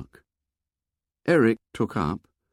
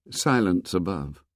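ordinary delivery

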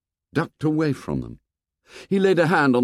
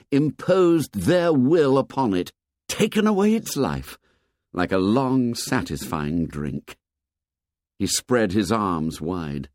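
plain reading voice

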